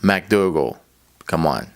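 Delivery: low put-on guy voice; made-up coworker voice. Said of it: "that's not at all how he sounds".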